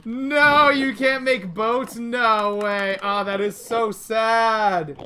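high, drawn-out wail of sadness; hammed-up grief, stretch it out; exaggerated sad whine; mock lament. Real, not acted. Lamenting the lost boats.

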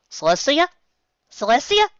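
put-on calling out in a high young voice. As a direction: call her name, high and hopeful. Megan calling out.

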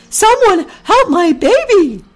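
very high, loud, drawn-out plea; frantic mother goose. A narrator's reading. Mother goose pleads for her drowning baby, panicked cry. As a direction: cry out desperately in a squawky goose voice. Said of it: "said Ginger angrily".

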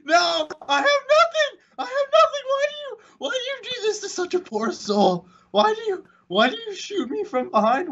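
high whiny pleading wail; raging gamer. Acted. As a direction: melodramatic victim wail, high and crying. Chased and killed by player.

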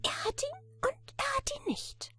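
very high, squeaky little voice; tiny creature piping up, high and squeaky; piepsiges Männlein. A narrator's reading. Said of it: "sagte das Männlein".

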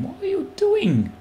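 very high, drawn-out squeal of disbelief; slow, soaring incredulous cry; exasperated cry. Real, not acted. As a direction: squeal it in protest at the player. Startled protest at game character.

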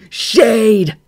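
high, very loud cry; cried out. A narrator's reading. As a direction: call out a name across the sky. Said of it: "he cried".

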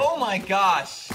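slow, high and drawn out; awed. Real, not acted. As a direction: stretch it in disbelief. Awed at flying monster.

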